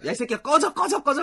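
high, loud pretend swearing; mock cursing. What the sung insult secretly means, mock cursing. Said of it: "막 욕을 하고 싶은데".

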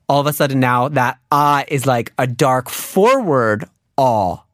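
normal talking voice

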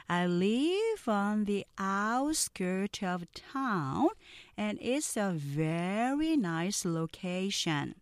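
very slow, carefully sounded English lines; slow model reading. Shown as a demonstration. Teacher reading slowly for students to repeat. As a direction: read each sentence slowly for repeating. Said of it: "느린 속도 듣고 따라해".

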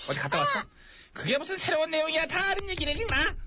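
indignant, high-pitched rebuke; exasperated scolding. Acted. King rebukes aide for news everyone knows, exasperated scolding. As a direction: tell him off, pitch climbing.

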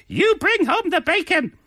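high, far-off mock mother calling out; mother's rallying cry. A mother urging her prize-fighter son on, high mock call.

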